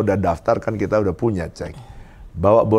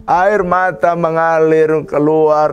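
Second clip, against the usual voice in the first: ordinary talk, nothing marked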